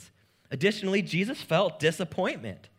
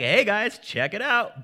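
excited showing off; 6 semitones higher, 6 dB louder than usual. Retold or imitated. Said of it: "He's like".

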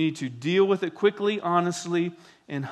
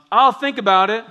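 louder, higher mimicked retort; mock grudging reply; 5 semitones higher, 10 dB louder than usual. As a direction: imitate someone withholding forgiveness.

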